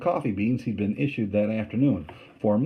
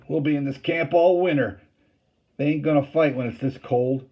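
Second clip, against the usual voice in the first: higher, louder dismissive soldier; scoffing; 5 semitones higher, 5 dB louder than usual. A narrator's reading. Campbell scoffing at the fighting, higher and louder. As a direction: wave off the worry with a scoff.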